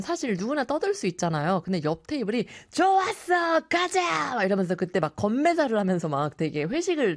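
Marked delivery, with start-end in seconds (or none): 2.7-4.3 high shouted rowdy cheer; rowdy diner's cheer. Retold or imitated. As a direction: yell it like a drinking table toast. Noisy next table cheering.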